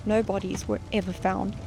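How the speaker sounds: plain reading voice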